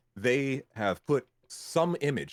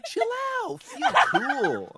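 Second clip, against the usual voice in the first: mock client; 11 semitones higher, 4 dB louder than usual. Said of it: "And they're like".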